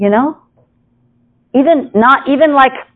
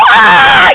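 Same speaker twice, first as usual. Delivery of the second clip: very high, loud squeal; hammed-up high shriek of alarm; mock scream; 6 dB louder than usual. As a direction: let out a short fake scream. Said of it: "and you're like".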